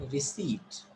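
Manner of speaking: "receipt" as ri-SEET, falling; receipt, silent P. Shown as a demonstration. Teacher repeating the word, receipt with silent P. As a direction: say "receipt" with no P and a long ee. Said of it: "We'll call it receipt".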